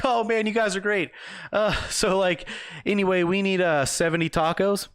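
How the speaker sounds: high, bubbly tipsy customer; hammed-up drunk woman ordering; drunk lady voice. Drunk customer ordering seventy tacos, high and giddy.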